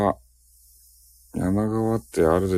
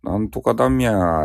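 higher-pitched caricature Nagoya "myaa"; mock Nagoya dialect; 5 semitones higher than usual. Streamer showing viewers how Nagoya people talk, mock Nagoya dialect. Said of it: "名古屋ってミャーミャー言うと".

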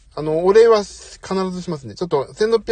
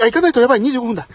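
startled realization; 7 semitones higher, 7 dB louder, faster than usual. Real, not acted. Realizes he is late for daughter.

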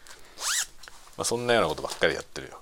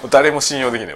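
loud, higher fed-up cry; exasperated outburst; 6 semitones higher, 10 dB louder than usual. Real, not acted. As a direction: blurt it out fed up. Exasperated outburst about coworkers. (呆れて声を張り上げる)

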